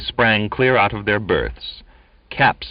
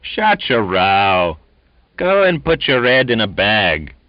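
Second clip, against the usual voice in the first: loud, slow annoyed shout; 3 semitones higher, 5 dB louder than usual. A narrator's reading. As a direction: shout them down. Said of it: "Others shouted".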